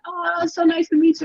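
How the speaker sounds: high-pitched imitation of a gracious star; sweet greeting. Star greeting fan, retold.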